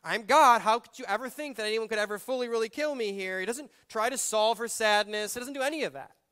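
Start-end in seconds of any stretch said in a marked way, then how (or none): 0.0-3.4 loud, high, stammering I-told-you-so; high exasperated rant; mock scolding Jesus. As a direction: scold her like an exasperated teacher.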